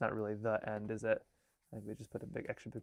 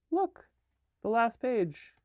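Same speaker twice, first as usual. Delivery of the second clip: much higher, eager look; child finding the last page; excited discovery; 11 semitones higher, 5 dB louder, slower than usual. Acted. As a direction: cry out in delight.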